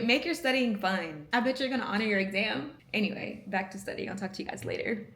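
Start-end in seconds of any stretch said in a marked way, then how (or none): none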